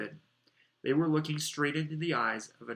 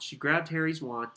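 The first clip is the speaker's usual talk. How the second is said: ordinary delivery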